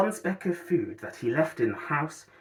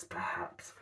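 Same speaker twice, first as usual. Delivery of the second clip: a soft unvoiced whisper on perhaps; 12 dB quieter than usual. A narrator's reading. The Grinch muses to himself in a whisper. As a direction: breathe the word, barely voiced.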